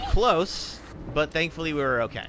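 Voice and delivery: ordinary talk, nothing marked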